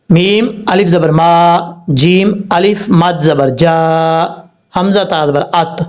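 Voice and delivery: ordinary delivery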